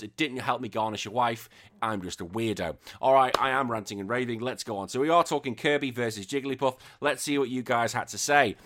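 normal talking voice

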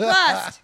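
a final loud splutter, falling; retold loud choking splutter; mock sputtering. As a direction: choke out the last gasp of water. Waterboarded writer sputtering under water.

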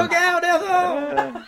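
high, slow, laughing cry; hammy old-timey shout. Acted. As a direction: ham it up like a B-movie. Mock TV drama line.

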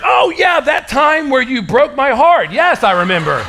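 high, loud, slow hammed-up heartbreak; mock dramatic wife. Pastor imitating his wife's teasing drama, hammed-up. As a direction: overplay the wounded wife, teasing. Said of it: "She's, like, putting it on hard".

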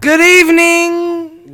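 announcer voice. Pretend. Grand announcer greeting.

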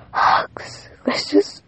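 breathy whisper of hugs. Real, not acted. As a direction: whisper it like a secret. Whispered after plain hugs.

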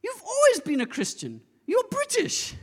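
high, loud, indignant British gran; mock outraged cry in a British accent. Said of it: "she speaks with this British accent".

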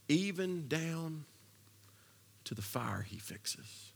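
slow, drawn-out hushed whisper. Acted. Preacher whispers Jesus's promise to the congregation, slow. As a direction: drop to a whisper to land it. Hushed climax of sermon point.

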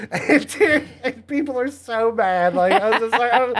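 laughing at how mad people got. Real, not acted.

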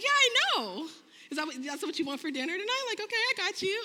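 high, loud, eager acceptance; delighted boasting. Retold or imitated. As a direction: play up being thrilled by the compliment. Retold delight at husband's praise.

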